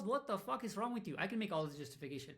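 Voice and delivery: ordinary delivery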